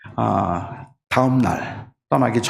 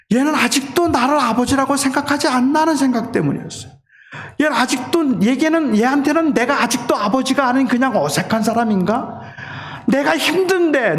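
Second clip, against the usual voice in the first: much higher, pleading sermon voice; impassioned preaching; 11 semitones higher than usual. Acted. Pastor telling the congregation about his son, impassioned preaching. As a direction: preach it from an aching heart.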